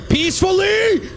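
pleading shout at the game; high pleading shout. Real, not acted.

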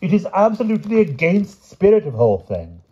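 high, loud outraged knight; angry cry. A narrator's reading. Sir Palomides scolds the king over his poem, angrily. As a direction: object angrily at high pitch. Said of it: "cried Sir Palomides angrily".

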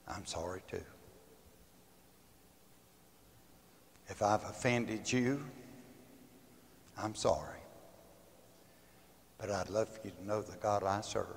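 soft, low, conciliatory; gentle apology. Retold or imitated. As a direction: apologize quietly and tenderly, pausing between phrases. Retold reconciliation after the quarrel.

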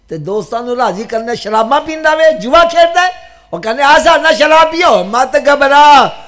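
loud sermon voice climbing high; impassioned preaching. Real, not acted. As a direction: call it out to the congregation with mounting force. Preaching at raised pitch.

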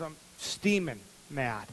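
low, slow pouty grumble; boy sulking at the table, low and slow; sulky grumble. Retold or imitated. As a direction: sulk it out. Sulking kid.

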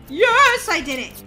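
high, loud cheer; triumphant shout. Real, not acted. Player who just jumped over the rat, triumphant shout. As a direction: cry out in victory.

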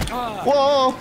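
high startled gasp of whoa; jumped by a scare. Real, not acted. Jump scare.

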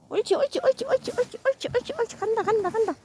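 high soft encouraging coo; encouraging praise. Real, not acted. Praising dog as it walks.

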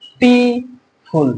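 Beautiful split into three long, held syllables. Shown as a demonstration. Teacher showing students three syllables, slowly split. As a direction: stretch beautiful as biu, ti, ful with gaps between. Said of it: "তিনবার উচ্চারণ করতে পারছো".

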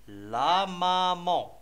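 "لا مامون" run together with long, held vowels; joined-up model reading. Shown as a demonstration. Teacher modelling for children to repeat, "لا مامون" drawn out and connected. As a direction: stretch "لا مامون" slowly without breaks. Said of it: "قبل ما نقراو لا مامون".

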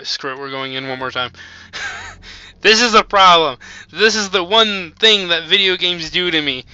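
ordinary delivery

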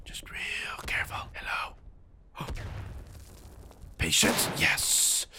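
soft, unvoiced sneaking whisper; sneaking through the trenches, whispering; stealthy whisper. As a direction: whisper like creeping up on an enemy.

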